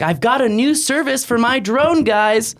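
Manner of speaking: high, eager retelling of a friend; excited quote voice. Retold or imitated. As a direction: pitch it up like an eager pitchman. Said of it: "he's like".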